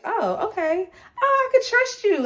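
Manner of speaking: put-on high childlike voice, opening up; relieved little self. Little self answering big self in meditation, childlike and trusting. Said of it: "to see her go from".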